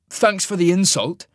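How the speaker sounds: put-on reply like a sulking teen; petulant. A narrator's reading. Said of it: "he replied petulantly".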